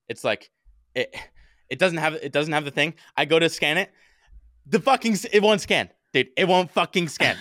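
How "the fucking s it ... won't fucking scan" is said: high, exasperated outburst; retold panicky frustration. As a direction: complain in rising frustration, pitched up. Re-enacts frustration at bike scanner.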